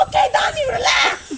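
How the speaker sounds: high, loud, irritated yell back; loud exasperated kid shouting back; annoyed shout. Retold or imitated. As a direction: snap back at the pushy friend.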